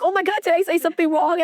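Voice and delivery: high, fast worried imitation; mock alarm. Coworker reacts to crying new mom, imitated alarm. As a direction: blurt it out high, afraid you caused the tears.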